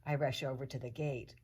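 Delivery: usual speaking voice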